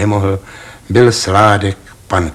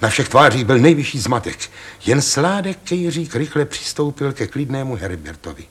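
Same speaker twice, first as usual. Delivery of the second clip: ordinary delivery, nothing marked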